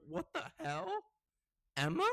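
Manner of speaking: high-pitched retold double take; startled disbelief. Birthday girl spotting her friend at the door, startled. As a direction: re-enact being caught off guard, pitch leaping up. Retelling shock at friend's arrival.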